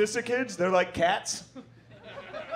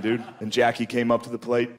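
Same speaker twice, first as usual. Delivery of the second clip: ordinary delivery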